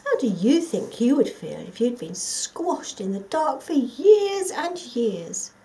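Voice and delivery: ordinary delivery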